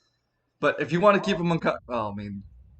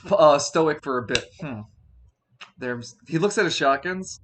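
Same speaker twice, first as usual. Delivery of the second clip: ordinary delivery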